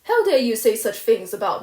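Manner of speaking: loud and angry but still in her own pitch; vehement. Acted. Furious girl to the neighbour, vehement but held in. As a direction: push back hard, held in. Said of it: "she repeated vehemently".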